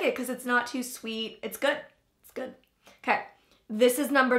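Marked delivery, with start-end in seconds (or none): 2.2-2.5 quiet. Real, not acted.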